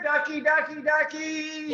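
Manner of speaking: calling a duck. Acted. Reader calling to the duck in the book, high and coaxing. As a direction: coax the duck over like calling a pet.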